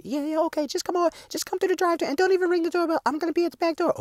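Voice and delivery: put-on high, quick, soft girly voice; girlish Tina voice. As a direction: play the sister sweet-talking her boyfriend. Voicing Tina on the phone.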